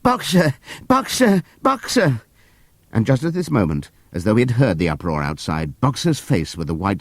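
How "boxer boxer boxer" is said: high, falling call; crying out. Acted. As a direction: call after him. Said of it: "she cried".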